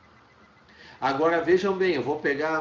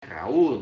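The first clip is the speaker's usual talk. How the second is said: Raul in two syllables, ra-UL; stress on U; 3 semitones lower, 4 dB louder than usual. Shown as a demonstration. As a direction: hit the U in Raul. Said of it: "Qual é a mais forte delas?".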